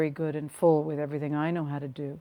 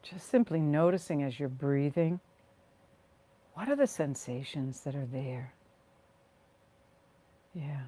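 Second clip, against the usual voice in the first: guided meditation. Real, not acted. Leading listeners to rest and ground through their feet, guided meditation. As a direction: ease them into the body, phrase by unhurried phrase.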